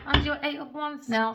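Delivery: usual speaking voice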